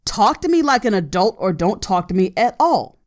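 usual speaking voice

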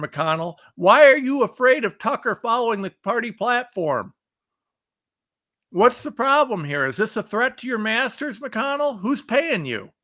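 high-pitched indignant questioning; fired-up rant. Real, not acted. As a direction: pepper him with angry questions. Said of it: "I am really fired up today".

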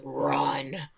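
low, quiet imitation of a feral growl; imitated animal command. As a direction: drop it low and soft, mock beast. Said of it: "she sounds like an animal".

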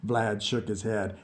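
ordinary delivery, nothing marked

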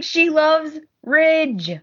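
mimicking Liam. Liam voiced retelling soap scene.

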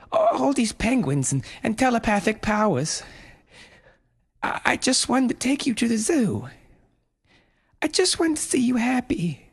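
high, tearful mother voice; pleading mother. A narrator's reading. Mother pleading with possessed son, high and tearful. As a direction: beg him gently, near tears.

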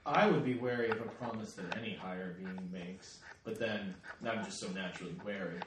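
low trickster voice. Acted. Coyote in character.

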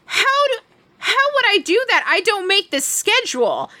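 high-pitched, incredulous comeback; indignant disbelief. Retold or imitated. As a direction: retell it as a high, baffled protest. Retold indignant confrontation with accuser.

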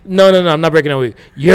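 ordinary talk, nothing marked

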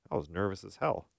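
normal talking voice

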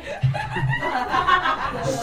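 high laugh. Real, not acted. Laughing at joke.

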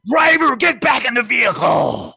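high, loud, drawn-out command; shouted police order. Retold or imitated. Policeman with hand on his gun ordering a driver, shouting. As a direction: bellow it as an alarmed officer at full volume.

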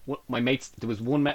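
ordinary talk, nothing marked

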